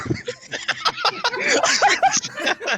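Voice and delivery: very high laugh; shrieking laughter. Real, not acted. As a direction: lose it completely. Laughing at "fodder.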